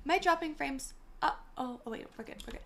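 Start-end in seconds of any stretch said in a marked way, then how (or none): none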